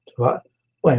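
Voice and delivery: usual speaking voice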